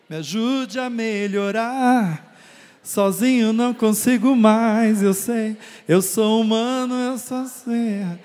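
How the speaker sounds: loud, slow, pleading cry for help; pleading prayer. Acted. Preacher voicing a believer begging God for help, loud slow plea. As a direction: beg God out loud, drawn out.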